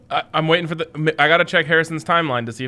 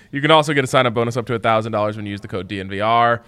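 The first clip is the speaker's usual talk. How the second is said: fast legal disclaimer; 3 semitones lower than usual. Acted. Sponsor ad read.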